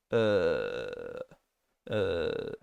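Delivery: a loose, neutral "uh" schwa sound, tongue at rest; schwa demo. Shown as a demonstration. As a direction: let "uh" out relaxed and central. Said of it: "You just let it loose and say".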